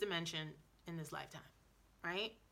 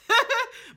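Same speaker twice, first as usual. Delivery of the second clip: sudden high, loud burst of laughter; 14 semitones higher, 14 dB louder than usual. Real, not acted. Laughs at own self-doubt remark.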